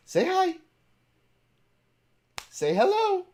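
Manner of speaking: high and loud coaxing; high, loud sing-song call; high, loud pet command. Real, not acted. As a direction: urge the dog to bark. Urging the dog.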